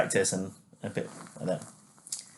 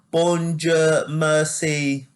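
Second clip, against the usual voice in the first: put-on French words in a plain English accent, pitched higher; 6 semitones higher, much slower than usual. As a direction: imitate English people speaking French, slightly higher. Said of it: "English people speaking, or, um, you know, speaking French".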